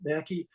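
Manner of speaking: Mackey as MACK-ee; pronounced Mackey. Shown as a demonstration. Author joking about Scots names, Mackey as MACK-ee. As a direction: stress the first syllable of Mackey, ending "-ee". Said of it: "pronounce it however you like".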